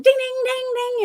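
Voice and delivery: high loud ding ding; arcade ding imitation. Describing noisy arcade games, high dinging. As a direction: ring it like an arcade machine.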